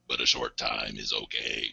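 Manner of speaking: very low buzzing robot voice; deep synthesizer drone from the throat; eighties robot voice. Shown as a demonstration. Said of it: "stress my vocal cords to the limit".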